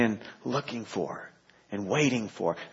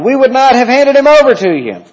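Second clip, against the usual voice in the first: much higher, loud accusing crowd; voicing the accusers; 13 semitones higher, 12 dB louder, faster than usual. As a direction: shout it up high like the angry crowd. Crowd voiced in reading.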